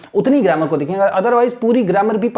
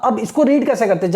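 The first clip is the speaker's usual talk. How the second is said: usual speaking voice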